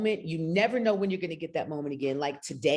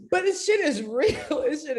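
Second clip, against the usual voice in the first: laughing; 9 semitones higher, 4 dB louder than usual. Real, not acted. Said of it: "Making me cry".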